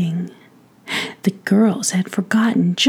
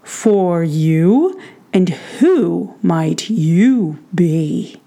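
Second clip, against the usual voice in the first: slow, booming giant questioning; 3 semitones lower, 3 dB louder, much slower than usual. A narrator's reading. Giant king questions the small prince, slow giant voice. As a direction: stretch it out with big, swooping stress. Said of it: "asked the giant in his giant voice".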